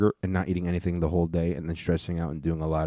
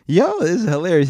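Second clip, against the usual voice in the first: excited; 10 semitones higher, 7 dB louder than usual. Real, not acted. Reacting to funny clip.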